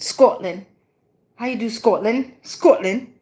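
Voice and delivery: tentative, amateur Scottish accent; attempted Scottish accent. Shown as a demonstration. Reader trying out an accent on stream, tentative Scottish. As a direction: have a go at Scottish, rounding the O. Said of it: "see if I can get me a Scottish accent".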